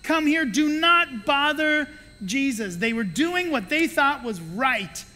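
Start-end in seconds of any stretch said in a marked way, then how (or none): 0.0-2.8 loud, slow, higher scolding; firm rebuke. Retold or imitated. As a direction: forbid them sternly. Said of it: "they said".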